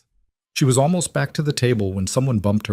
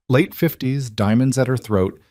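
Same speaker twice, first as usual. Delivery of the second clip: ordinary delivery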